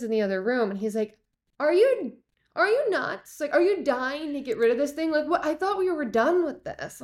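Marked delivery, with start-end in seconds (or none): 1.6-7.0 high-pitched mock exasperation; squeaky-high, baffled protest; incredulous husband. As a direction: imitate a disbelieving husband, pitch way up. Husband's exasperated reaction, retold.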